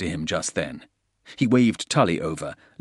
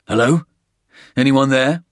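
raised, loud questioning call; calling into static; 6 semitones higher, 7 dB louder than usual. Acted. Porter hears nothing but static on the sat phone, calling out loudly. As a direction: call out to see who is on the line.

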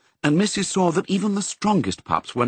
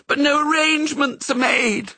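a much higher wailing cry; wailed; 3 dB louder than usual. A narrator's reading. The setter despairing over the failed plans, wailing. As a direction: let it spill out in a helpless wail. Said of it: "wailed the setter".

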